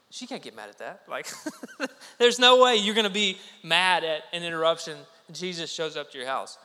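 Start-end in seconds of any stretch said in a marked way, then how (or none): 1.8-5.0 words through real laughter; laughing. Real, not acted. The preacher amused by his own joke to the congregation, laughing. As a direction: laugh your way through the line.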